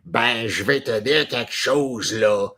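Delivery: imitating his wife's broad Québécois French accent. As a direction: put on a thick Québécois accent, a bit mocking. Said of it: "that's how the Quebecer speaks".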